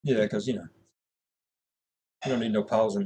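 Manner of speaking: usual speaking voice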